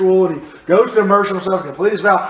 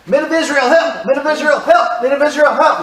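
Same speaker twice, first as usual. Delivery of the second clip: high, loud repeated cries for help; loud shout; 7 semitones higher than usual. Acted. Mob in the temple calling for help, high loud shouting. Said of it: "loudly and continuously shouting".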